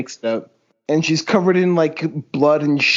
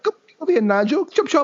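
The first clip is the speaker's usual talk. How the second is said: much higher, bossy mock British voice; 9 semitones higher, faster than usual. Co-host bossing Nigel in a silly British voice. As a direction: order Nigel about like a posh toff.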